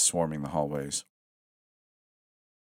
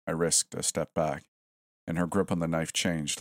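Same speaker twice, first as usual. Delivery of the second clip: usual speaking voice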